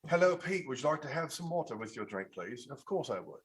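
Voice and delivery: genteel British accent demo; sophisticated British accent. Shown as a demonstration. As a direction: speak in polite, sophisticated British. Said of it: "you're more sophisticated, more like, you know, more British".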